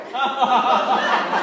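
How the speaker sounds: bursting out laughing at the memory; a big real laugh; loud amused laugh. Real, not acted. Laughing at mom's reaction.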